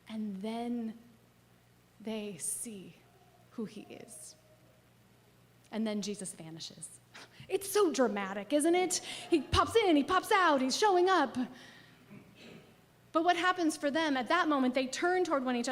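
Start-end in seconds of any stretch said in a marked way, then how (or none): 3.5-4.3 whispered softly in awe; hushed reveal. Acted. Revelation moment in the story. 9.3-11.4 higher and louder, bouncy and delighted; playful excitement. Acted. Playful retelling of Jesus vanishing.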